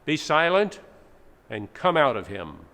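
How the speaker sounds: loud, commanding rebuke; stern rebuke. Acted. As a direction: order the spirit out with authority. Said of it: "But Jesus rebuked the spirit".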